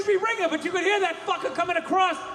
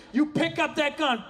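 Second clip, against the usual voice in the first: usual speaking voice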